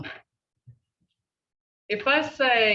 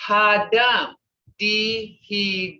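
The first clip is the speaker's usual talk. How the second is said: broken into sounds; 4 dB louder than usual. Shown as a demonstration. Teacher showing how sounds are broken, "Hadam dihijagathis" syllable by syllable. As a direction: stretch and separate each sound in "Hadam dihijagathis". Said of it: "See how they're broken the sounds?".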